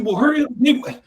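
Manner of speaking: giddy tipsy shout; giddy drunk request. Retold or imitated. Host begging friend for a photo, high giddy shout. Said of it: "I said".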